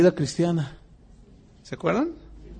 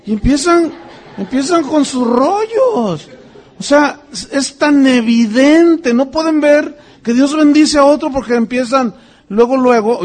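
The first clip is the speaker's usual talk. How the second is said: indignant preaching; 6 semitones higher, 7 dB louder than usual. Real, not acted. Preacher condemning envious brethren, indignant. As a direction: drive home the outrage.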